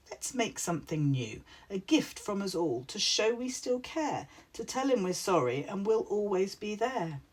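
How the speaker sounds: ordinary delivery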